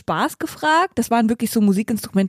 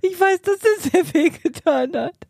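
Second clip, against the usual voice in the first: real laugh-crying, slightly higher; sorry, but tears of laughter take over; laughing apology; 6 semitones higher, slower than usual. Real, not acted. Girlfriend apologizing for his broken back, laugh-crying. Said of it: "Sie lacht sich kaputt".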